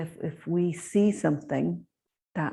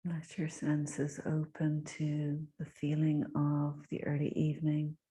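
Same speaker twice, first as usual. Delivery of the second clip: guided meditation; 6 dB quieter than usual. Real, not acted. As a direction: guide them gently inward, softly and unhurried.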